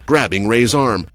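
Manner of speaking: ordinary delivery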